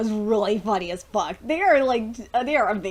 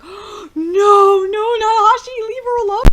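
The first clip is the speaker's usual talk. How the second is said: high, loud wail of protest; distressed pleading; 11 semitones higher, 10 dB louder than usual. Retold or imitated. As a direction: beg a villain to spare someone. Reacting in dread for Ashi.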